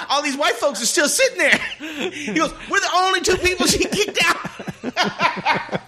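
laughing while telling. Real, not acted. Laughing through the retold punchline.

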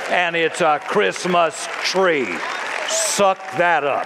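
slow, emphatic defiance; defiant retort. Acted. Preacher rebutting holiday trees, slow defiant retort. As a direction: draw each word out defiantly.

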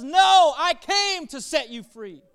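preacher voicing Jesus' emphatic promise; Jesus declaring. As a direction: cry it out to set them free. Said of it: "And Jesus says".

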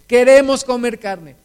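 put-on a childish shouted demand; mock whiny demand. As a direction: ham up the crowd clamoring for meat. People demand meat, mocked.

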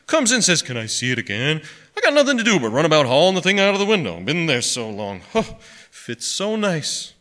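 mumbled gripe building to a high, quick outburst; grumbling to himself. A narrator's reading. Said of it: "talking to himself".

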